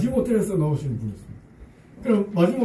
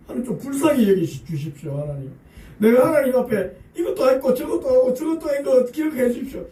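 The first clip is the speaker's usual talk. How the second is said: imitating a pleading prayer; 7 semitones higher than usual. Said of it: "하나님 긍휼을 호소하고 있습니다".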